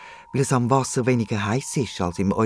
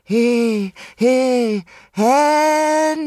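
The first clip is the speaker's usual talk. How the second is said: high, drawn-out call; 11 semitones higher, 4 dB louder, much slower than usual. A narrator's reading. Animals call Henry at his cave entrance, high drawn-out holler. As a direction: holler for a missing friend. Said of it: "rufen sie im Chor".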